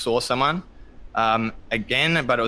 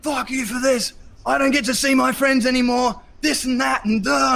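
high, loud furious shout; angry yelling; 12 semitones higher, 3 dB louder than usual. Retold or imitated. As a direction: let the anger explode at her. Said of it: "started vomiting anger over my wife".

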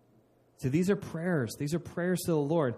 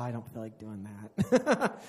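mock reluctance; 4 semitones lower, slower than usual. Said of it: "you're like".

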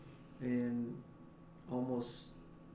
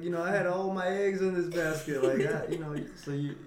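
ordinary talk, nothing marked

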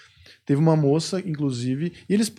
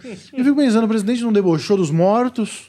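indignant; 6 semitones higher, 4 dB louder than usual. Real, not acted. Indignant rant about president.

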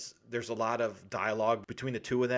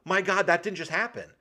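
shocked disbelief; 7 semitones higher, 5 dB louder than usual. Retold or imitated. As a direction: gasp it out in disbelief. Reenacting audience shock.

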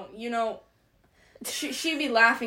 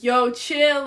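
louder, higher teasing imitation; joking echo; 4 semitones higher, 9 dB louder than usual. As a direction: throw it back as a mock catchphrase. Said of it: "like, back at me as a joke".

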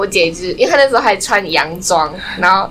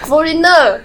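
quoting the sister; 8 semitones higher, 4 dB louder, slower than usual. Retold or imitated. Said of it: "我姐就".

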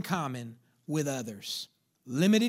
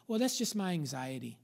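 softly voiced, dismissive excuse; resigned excuse; 5 dB quieter than usual. Voicing someone owning their anxiety.

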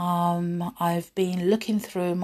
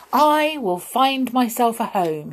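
raised, determined hero voice; higher, emphatic proclamation; resolute declaration; 5 semitones higher, 6 dB louder than usual. A narrator's reading. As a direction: declare it boldly with a long "I". Dog declares his resolve.

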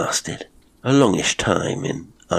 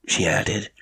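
usual speaking voice

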